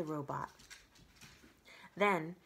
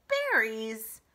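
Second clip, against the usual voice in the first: disgusted; 3 semitones higher, 7 dB louder than usual. Acted. Fox disgusted.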